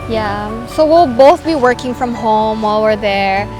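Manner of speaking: usual speaking voice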